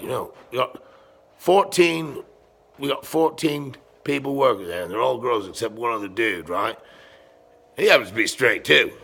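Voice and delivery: ordinary delivery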